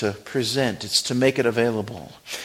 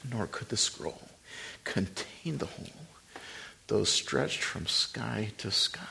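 hymn recitation; 3 dB quieter than usual. Acted. Preacher softly reciting a hymn to the congregation. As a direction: hush the words of the old hymn.

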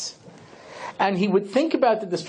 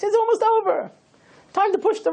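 mock questioner. Mocking the questioner again.

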